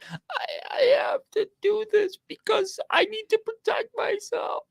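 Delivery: high, whispered, frightened hostage plea; a high, breathy whisper mocking a frightened hostage. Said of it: "like she's a hostage, like she's in tears".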